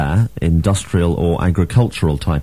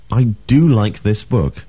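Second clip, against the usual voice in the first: exclamation with "do" stressed; exclaim, leaning on "do"; exclamatory emphatic DO. Shown as a demonstration. Teacher gives an example of exclamation, "do" stressed. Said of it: "Another use of the emphatic do".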